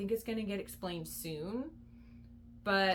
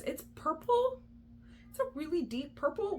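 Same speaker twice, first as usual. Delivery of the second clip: surprised insistence; 8 semitones higher than usual. Real, not acted. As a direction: stretch "purple" high in disbelief. Insisting drink is purple.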